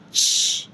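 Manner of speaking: sh begun sharply, like "tch"; S H sound switched. Shown as a demonstration. Teacher showing the switched sound for S H, sharp "ch". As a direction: burst into sh as a "ch" sound. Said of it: "just know you pronounce it as".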